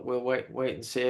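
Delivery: usual speaking voice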